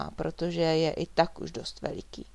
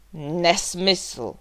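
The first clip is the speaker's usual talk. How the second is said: loud, forceful denial; big roared objection; roaring; 8 dB louder than usual. A narrator's reading. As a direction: bellow it at the speaker's own pitch. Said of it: "zařval Vladimír".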